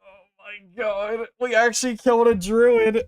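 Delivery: excited disbelief. Real, not acted. Reacting to killing a druid.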